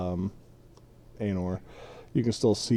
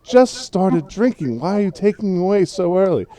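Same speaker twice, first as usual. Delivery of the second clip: higher-pitched tipsy hobbit complaint; sloshed hobbit; 8 semitones higher, 5 dB louder than usual. Acted. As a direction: whine a little like a merry drunk hobbit. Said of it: "looks a little sloshed".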